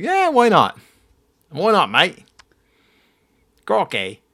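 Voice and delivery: jokey high, loud Australian bloke voice; hammed-up Australian mate; Australian accent. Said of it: "That's Australian".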